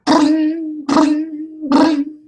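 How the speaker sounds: high ringing mimicked in the voice; phone ring. GM imitating the cell phone ringing.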